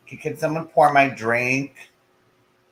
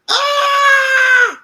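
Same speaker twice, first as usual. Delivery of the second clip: huge high alien shriek; space monster; 11 dB louder than usual. Pretend. Showing off a space monster voice, loud shriek. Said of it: "I could do a space monster".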